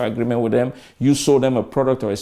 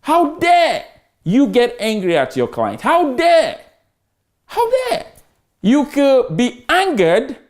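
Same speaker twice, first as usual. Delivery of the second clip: high, slow indignant how dare; hammed-up indignation at angry reps; mock outrage; 10 semitones higher, slower than usual. Shown as a demonstration. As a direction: act scandalized at the idea. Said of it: "emotional intelligence".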